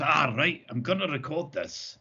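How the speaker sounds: imitating a friend's Scottish accent; retold line in a mock Scottish accent. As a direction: put on a Scottish accent for the quote. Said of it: "but in a Scottish accent".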